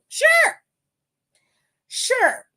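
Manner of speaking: very high, drawn-out sure; mock agreement, dripping with doubt; sarcastic sure. Real, not acted. Mocking a wrestler's excuse, sarcastic high sure. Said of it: "sarcastic dismissal of Gable".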